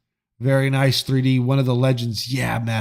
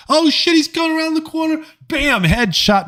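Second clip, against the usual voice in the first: mock hyped streamer; 14 semitones higher, 3 dB louder than usual. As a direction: parody a streamer faking surprise on a replayed level. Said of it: "they're like".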